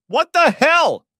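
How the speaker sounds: very high, loud, slow scream. Acted. Jane, blood-spattered at the table, screaming. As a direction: shriek it in horror. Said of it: "screamed Jane".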